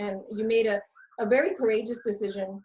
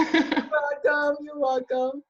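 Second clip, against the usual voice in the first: laughing; 5 semitones higher, 6 dB louder, slower than usual. Real, not acted. Laughing thanks after the interview.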